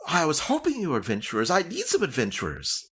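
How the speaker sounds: high, fast delighted gasp; eager Nimsy. Acted. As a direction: jump at the word, thrilled.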